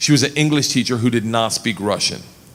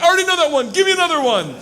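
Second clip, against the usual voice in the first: eager demand; faster than usual. Retold or imitated. Impatient self demanding next.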